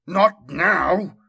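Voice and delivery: loud, fierce growl; growled shout. A narrator's reading. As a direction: snarl it at full force. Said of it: "Uzaz growled".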